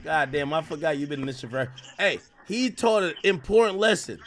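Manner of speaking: ordinary delivery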